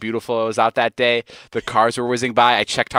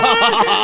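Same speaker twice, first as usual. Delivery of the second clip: laugh; 11 semitones higher, 5 dB louder than usual. Real, not acted. Laughs at Megan's teasing.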